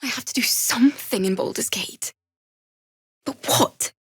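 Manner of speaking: fretting under her breath, just air; whispered worry. Acted. Bard alone, unsure what to do, whispering. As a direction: whisper it, voice almost gone. Bard wondering aloud to herself.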